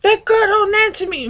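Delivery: high-pitched grumbling grandma; complaining grandma. Retold or imitated. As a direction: whine it high like an exasperated grandma. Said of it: "she goes".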